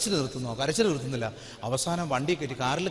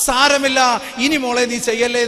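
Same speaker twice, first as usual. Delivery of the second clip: gentle forgiveness, voiced loud; 5 semitones higher, 11 dB louder than usual. God's voice in confession.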